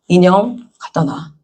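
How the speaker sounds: low, slow stern command; extremely deep bass voice. Retold or imitated. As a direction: drop the voice and order slowly. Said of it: "목소리 깔고".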